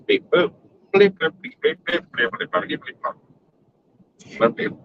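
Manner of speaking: high robot beeps trailing off to nothing; robot bleeps fading out. Pretend. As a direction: beep like a robot, fading away.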